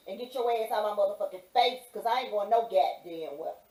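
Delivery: ordinary talk, nothing marked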